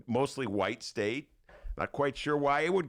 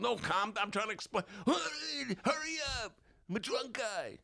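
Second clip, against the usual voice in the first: drunk guy impression; 10 semitones higher than usual. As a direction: play an impatient drunk yelling. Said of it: "that's my drunk guy imitation".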